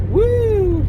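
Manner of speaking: high, loud cheer; excited whoop. Real, not acted. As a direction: let out a joyful whoop. Cheering while driving.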